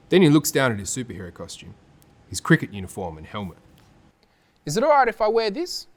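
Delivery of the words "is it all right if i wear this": put-on high, hopeful kid voice; hopeful young boy. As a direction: ask with shy hope. Maku asking hopefully.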